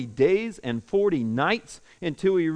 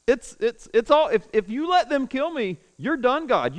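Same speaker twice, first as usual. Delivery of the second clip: high-pitched whining complaint; whiny Elijah; 7 semitones higher, 3 dB louder than usual. Retold or imitated. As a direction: whine at God like a sulking child. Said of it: "that's his attitude".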